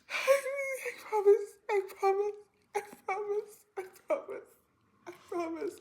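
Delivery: very high, squeaky mock whimper; tearful pleading. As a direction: plead in a tiny crying voice. Acted panicked pleading while retelling.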